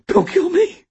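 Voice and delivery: high, loud begging; terrified plea. A narrator's reading. Cashier pleads with the robber, terrified. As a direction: plead for your life.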